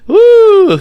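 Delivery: very high, loud whoop; whooping cheer. Real, not acted. Friend cheers the crude story with a whoop. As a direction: let out a wild whoop.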